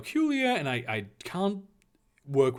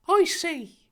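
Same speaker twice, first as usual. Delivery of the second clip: high, loud put-on voice; uncertain Harry; 7 dB louder than usual. Harry conceding to Dumbledore, uncertainly. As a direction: pipe up a concession. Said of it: "Harry conceded uncertainly".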